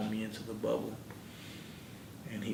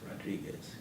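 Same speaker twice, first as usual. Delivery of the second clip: soft whisper of a name; 8 dB quieter than usual. Retold or imitated. Guard waking inmate at night, whispered call. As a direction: whisper so the sleepers don't wake.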